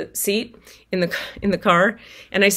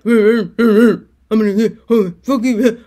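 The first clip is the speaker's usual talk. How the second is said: slow, louder guttural put-on man voice; grunting man; 7 dB louder, slower than usual. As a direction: grunt it out like a rough stranger outside. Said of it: "what sounded like grunting man noises".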